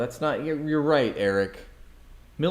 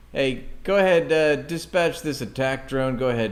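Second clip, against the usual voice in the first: louder, higher mock radio order; mock commander; 4 semitones higher, 6 dB louder than usual. Streamer voices a commander ordering a drone, mock radio voice. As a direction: bark it like a lazy commander.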